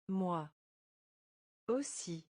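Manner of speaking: each word said apart with a pause. Shown as a demonstration. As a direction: split the phrase into single words for learners. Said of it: "teacher splits phrase word by word".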